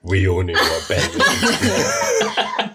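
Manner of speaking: laughing. Real, not acted. Listener laughing, slow comment.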